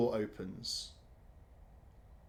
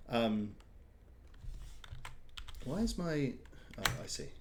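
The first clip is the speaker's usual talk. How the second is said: ordinary talk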